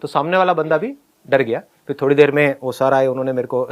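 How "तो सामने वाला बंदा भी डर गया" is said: normal talking voice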